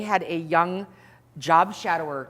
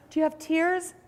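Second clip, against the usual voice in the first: much higher, exaggerated innocent question; mock trainee; 10 semitones higher than usual. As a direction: parody the earnest kid. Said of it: "He goes".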